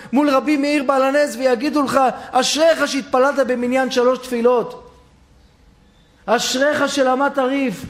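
high, loud rousing preaching that builds to shouts; preaching at full force. Acted. Preacher promising the son his heavenly reward, rousing build. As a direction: rise and rise to a blessing shout.

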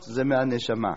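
ordinary delivery

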